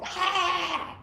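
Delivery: sudden high angry shout; angry outburst. Retold or imitated. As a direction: let the anger burst out in one cry. Fire of anger let go.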